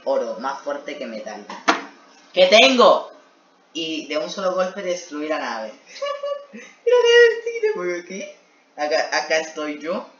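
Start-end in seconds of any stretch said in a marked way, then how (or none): 2.3-3.1 loud, high cry of disbelief; exasperated outburst. Real, not acted. Exasperated at own story. 5.7-8.2 high, squeaky laughing words. Real, not acted. Laughing at story.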